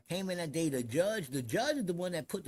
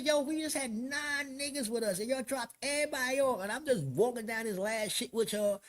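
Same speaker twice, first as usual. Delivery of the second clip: high-pitched retold arguing; worked-up retelling of an objection; indignant protest; 7 semitones higher than usual. Retold protest at being moved.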